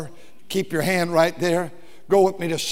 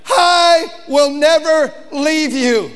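loud, slow, high preaching cry; shouted promise; 8 semitones higher, 6 dB louder, slower than usual. Acted. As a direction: proclaim it with full force. Preacher repeats verse, shouting.

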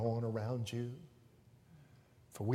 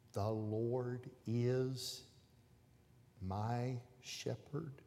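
very soft, very slow, barely voiced; mouthed words; 8 dB quieter, much slower than usual. Teacher silently mouthing the verse to the stuck boy. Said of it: "she mouthed the words to him".

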